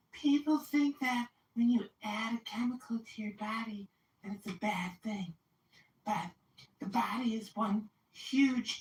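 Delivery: high, airy mock voice of Yoni; mocking impression. To the host, parroting Yoni's chemical argument in a high mocking voice. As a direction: parody her logic in a silly high voice.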